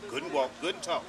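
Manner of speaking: ordinary talk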